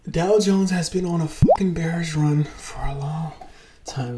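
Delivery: loud hushed whisper. Real, not acted. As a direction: whisper it urgently. Sudden excited whisper.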